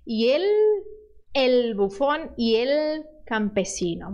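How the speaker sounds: el with a firm, full L, slowly; strong L. Shown as a demonstration. Teacher showing a strong Spanish L. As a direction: lean into each L in el bufón. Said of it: "bota força".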